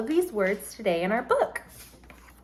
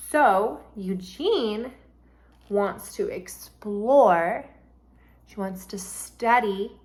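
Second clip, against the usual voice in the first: slow explanation; slower than usual. Shown as a demonstration. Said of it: "slow explanation to students".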